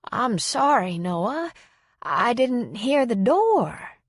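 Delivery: put-on drawn-out apology of a muddled elderly woman; lightly voiced confused elderly woman; confused old woman. A narrator's reading.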